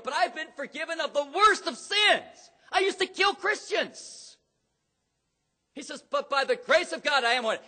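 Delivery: loud, high-pitched sermon delivery; fiery preaching. Acted. Pastor preaching to the church at full force.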